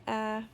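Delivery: short "uh" on one even note; brief steady "uh" sound. Shown as a demonstration. Teacher says goodbye to the crossed-out sound, steady "uh". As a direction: give the vowel evenly, briefly. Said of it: "tell me the sounds again".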